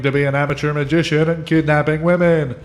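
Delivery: booming announcer calling contestants; game show host, big and showy. Acted. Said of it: "sounds like a game show host".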